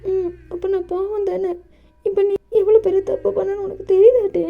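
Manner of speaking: high, teary voice rising to a reproach; sulk, then scold him; tearful young lover. Acted. JK tearfully reproaches Dev, high and rising.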